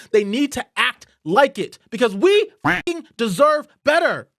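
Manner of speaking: loud, high, furious demand; real fury breaking through; angry outburst. Real, not acted. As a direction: let the anger boil over. Furious rant at Democrats.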